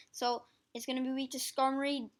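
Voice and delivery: ordinary talk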